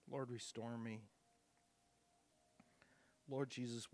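pastor praying for the lost, fervent then hushed; leading a prayer. Acted. Pastor leads closing prayer.

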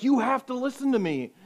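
very high, very loud demand; piercing high shout; full shout. Retold or imitated. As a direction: yell it as if grabbing his neck. Girl's demand again.

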